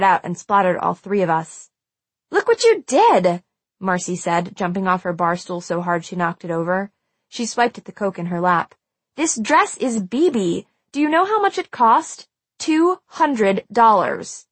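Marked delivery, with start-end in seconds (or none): 2.3-3.4 high, loud, drawn-out accusation; outraged. A narrator's reading. Marcy outraged over spilled Coke. 9.2-14.5 slow, emphatic, higher-pitched indignation; outraged. A narrator's reading. Marcy outraged over ruined dress.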